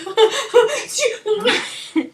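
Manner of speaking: loud, high burst of laughter; acted-out laughing fit. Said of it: "I was like".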